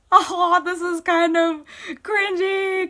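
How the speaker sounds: gasp, then high, loud fangirl squeal of words; high-pitched, loud, delighted cringe; fangirl cringing. Acted. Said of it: "it's like fangirl cringing".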